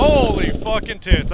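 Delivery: slow loud holy-cow outburst; loud exclamation. Real, not acted. Reacting under fire.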